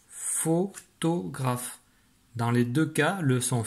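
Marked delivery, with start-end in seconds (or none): 0.1-1.8 higher, very slow, separated syllables. Shown as a demonstration. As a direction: stretch the word out in three parts. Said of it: "et au tout début". (mot très lent, découpé en syllabes)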